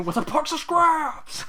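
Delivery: slow, high, loud mock roar; drawn-out shout of comic outrage; angry movie quote. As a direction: yell it again in hammed-up fury. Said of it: "he's so angry".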